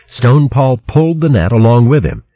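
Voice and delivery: ordinary delivery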